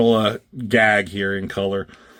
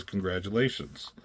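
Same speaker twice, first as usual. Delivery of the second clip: quiet and deflated; 3 semitones lower, 10 dB quieter than usual. Retold or imitated. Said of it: "And he says".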